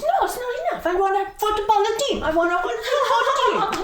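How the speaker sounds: very high, loud, insistent Italian grandma; Italian nonna. Acted. Nonna demanding a football team of grandbabies, shrieking Italian nonna. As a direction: demand it at full comic pitch as a nonna. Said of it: "I'm doing my Italian nonna".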